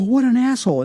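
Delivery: indignant outburst. Retold or imitated. Driver cursing the swerving car: high indignant cry. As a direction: fling the insult up high in outrage. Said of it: "Now I'm angry".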